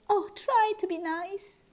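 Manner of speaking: high, pleading voice; frightened lion whimper. Acted. The scared lion pleads with the mouse. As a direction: beg the mouse not to hurt you. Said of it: "he whimpered".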